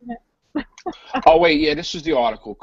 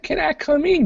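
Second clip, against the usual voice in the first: put-on higher voice, asking to enter; mock guest request; 14 semitones higher than usual. Host voices a guest asking to join, high put-on voice. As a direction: play a visitor asking in a high voice.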